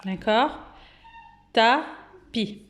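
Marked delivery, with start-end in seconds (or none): 1.5-2.6 "Tapis" as ta, pause, pi; teacher's detached "Ta-pis"; syllable by syllable. Shown as a demonstration. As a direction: separate both syllables of "Tapis". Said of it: "on l'entend dans la première syllabe".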